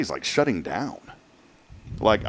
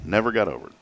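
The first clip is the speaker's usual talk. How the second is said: ordinary talk, nothing marked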